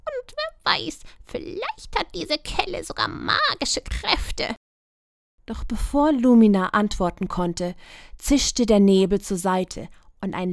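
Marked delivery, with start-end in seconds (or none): 0.0-4.5 very high, offended magpie; indignant croak. A narrator's reading. Magpie Vika defending the rusty ladle, high and offended. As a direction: huff in outraged defence. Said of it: "Vika krächzte empört".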